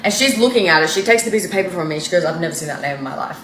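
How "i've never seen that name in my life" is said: quiet denial. Retold or imitated. Acting out the mother's denial.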